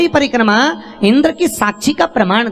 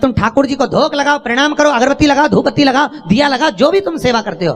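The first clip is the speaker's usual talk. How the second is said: higher, rising preacher's plea; fervent preaching; 8 semitones higher than usual. Acted. Preacher urging devotees to worship at home, fervent higher plea. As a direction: urge the devotees warmly and insistently.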